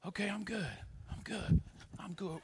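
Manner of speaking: hushed, acted relief; quiet self-reassurance. Player reassured by seeing dad in stands, quietly. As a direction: softly settle yourself.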